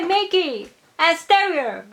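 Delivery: normal talking voice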